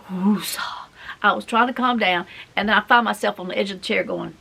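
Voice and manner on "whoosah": a calming breath, whispered. Retold or imitated.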